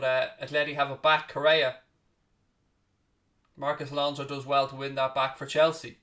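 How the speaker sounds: steady play-by-play, calling each pass as it happens; live commentary. Acted. Calling the Atletico v Chelsea match for viewers, play-by-play commentary. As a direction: talk the match through like a broadcast caller.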